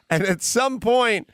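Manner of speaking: normal talking voice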